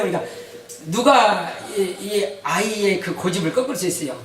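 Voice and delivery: usual speaking voice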